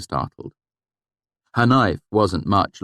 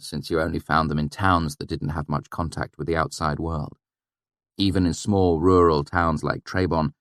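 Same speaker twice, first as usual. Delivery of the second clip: usual speaking voice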